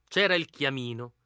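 plain reading voice

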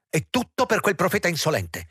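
high-pitched incredulous scorn; exasperated, pitch leaping up; indignant outburst. A narrator's reading. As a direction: blurt it out high, affronted. Upset man's indignant outburst.

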